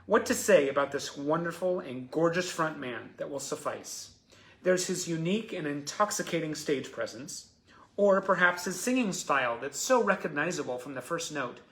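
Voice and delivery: lively, emphatic tribute read; fan letter reading. Real, not acted. Host reads Natalie's praise of the front man, emphatic letter reading. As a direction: build the praise with rising enthusiasm.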